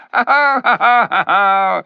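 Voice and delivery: high, loud ha ha laugh; jeering chuckle. A narrator's reading. The ape jeering at the backing beasts with a laugh. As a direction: chuckle at their fear. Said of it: "he chuckled".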